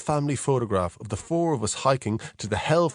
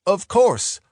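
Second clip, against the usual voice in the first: a brisk, clipped-short Of course, louder and higher; brisk, businesslike brush-off, said loud and high; 9 semitones higher, 6 dB louder than usual. A narrator's reading. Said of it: "I said briskly".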